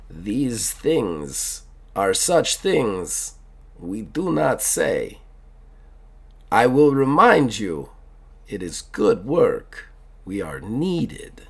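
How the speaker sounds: put-on very slow, grave Russian accent with long pauses; real serious. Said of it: "he gets real serious all of a sudden".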